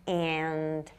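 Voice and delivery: flat Midwestern vowels on what do you want; lightly imitating a Midwestern accent. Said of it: "all the A's were".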